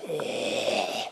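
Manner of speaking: a soft, breathy raptor hiss, no voice. Pretend. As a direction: hiss low like a lurking predator. Raptor scaring the neighbours.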